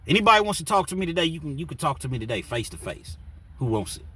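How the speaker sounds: fast, loud, high call-out to the room; taunting challenge. Retold or imitated. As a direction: provoke everyone at once. John taunting the cell block.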